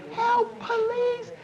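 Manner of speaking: high, drawn-out cry at held-back volume; mock a scream as neighbors would faintly hear it; faint distant scream. Shown as a demonstration. Instructor shows a cry heard from inside the elevator, faint high scream. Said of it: "they're hearing you just like this".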